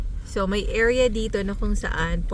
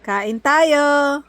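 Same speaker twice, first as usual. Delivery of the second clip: loud, higher call to eat; 6 semitones higher, 6 dB louder than usual. Real, not acted. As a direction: call everyone to the table. Calling everyone to eat.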